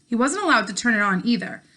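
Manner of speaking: steady, clear read-aloud narration for a class; story narration. A narrator's reading. Teacher reading a novel chapter to her class, in an even storytelling voice. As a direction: read the chapter out to your students, voicing each character lightly.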